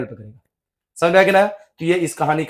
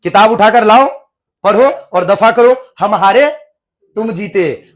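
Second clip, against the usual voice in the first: louder, slowed barking of orders; loud exasperated teacher; 2 semitones higher, 6 dB louder than usual. Retold or imitated. Maulvi loudly tells boys to fetch books and go. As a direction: throw the order out loudly, fed up. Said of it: "क्या कहा?".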